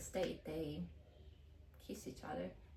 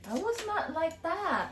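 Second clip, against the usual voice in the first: protesting; 10 semitones higher, 4 dB louder, slower than usual. Real, not acted. Denying his story, laughing.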